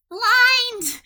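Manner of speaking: high, loud cry of the spell; sudden high-pitched shout. Acted. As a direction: yell it out like casting a spell. Player calls out spell in character.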